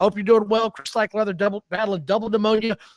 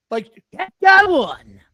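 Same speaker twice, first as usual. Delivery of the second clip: mock sports call; 7 semitones higher, 6 dB louder, slower than usual. Mocking referee's throwing motions.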